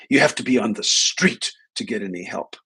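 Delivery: imitating a woman's indignant complaint; mock exasperated protest, leaning on "street"; righteous indignation. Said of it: "with great righteous indignance".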